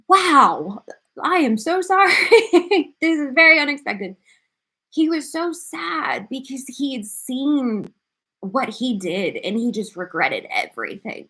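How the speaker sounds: high, tearful voice with a teary laugh, apologising; crying while talking. Real, not acted. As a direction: talk through real tears, barely holding on. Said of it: "I gotta get myself together".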